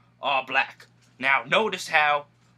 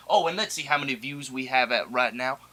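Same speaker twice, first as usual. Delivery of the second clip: usual speaking voice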